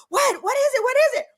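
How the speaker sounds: high, anxious rapid questions; panicked what is it; alarmed friends. Retold or imitated. Homegirls hear her scream, high alarmed questions.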